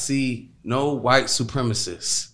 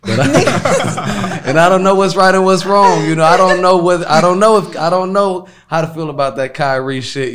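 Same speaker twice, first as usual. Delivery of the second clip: laughing; 10 semitones higher, 12 dB louder than usual. Real, not acted. Laughing through unsure rant.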